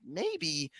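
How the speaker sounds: soft, shrugging "Maybe."; calm farmer. Retold or imitated. The farmer shrugging off the neighbors, soft and calm. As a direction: barely say it, unbothered. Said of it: "And he goes".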